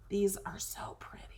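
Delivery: soft admiring whisper. Real, not acted. Crafter browsing pretty drawings, whispering. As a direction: hush it, almost to yourself.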